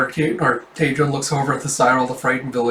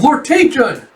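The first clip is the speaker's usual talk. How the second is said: high, loud cry of alarm; raised, panicked call; horrified cry; 12 semitones higher, 6 dB louder, slower than usual. Retold or imitated. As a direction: scream his name as the blade falls, acted. Lord is attacked with sword.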